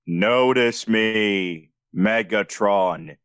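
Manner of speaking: very slow, low hero voice; low, drawn-out self-serious delivery; mock Optimus pausing. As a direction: stretch every word, deep and ponderous. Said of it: "the pausing".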